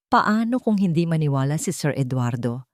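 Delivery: ordinary delivery, nothing marked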